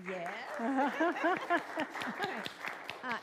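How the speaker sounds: usual speaking voice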